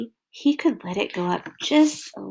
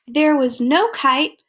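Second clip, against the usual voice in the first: usual speaking voice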